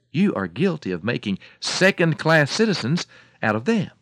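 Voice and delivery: loud, emphatic storybook lecture; scolding farmer. A narrator's reading. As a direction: rebuke the hen point by point.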